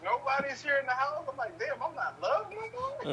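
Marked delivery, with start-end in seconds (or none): 1.6-3.1 mock self-pity. Child self quoted, self-pity.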